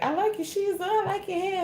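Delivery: very high, sweet gushing voice; hammed-up sugary stranger; compliment from stranger. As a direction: gush over someone's look.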